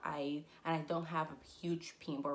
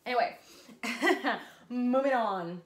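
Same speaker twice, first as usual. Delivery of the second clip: laugh, then loud brisk move on; laughing, louder; 5 semitones higher, 8 dB louder than usual. Real, not acted. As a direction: shake off the letdown with a laugh.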